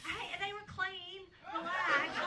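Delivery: laughing, tell them to relax, they were clean; mock indignant, giggling through it; laughing protest. Real, not acted. Defending herself to the crowd.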